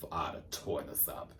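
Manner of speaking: soft whispered brag; hushed aside about the song. Real, not acted. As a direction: murmur it under your breath.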